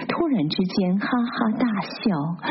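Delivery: plain reading voice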